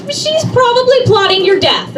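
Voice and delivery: spring the punchline high and loud; high mock-dire warning; ominous punchline. Real, not acted.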